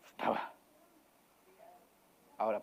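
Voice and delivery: ordinary delivery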